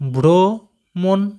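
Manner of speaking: ভ্রমণ stretched very slowly, x0.3, syllables pulled apart; slow careful pronunciation. Shown as a demonstration. Teacher to pupils, slowly demonstrating ভ্রমণ. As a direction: draw out ভ্রমণ slowly for the class. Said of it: "কেটে কেটে ভালো করে উচ্চারণটা করতে হবে".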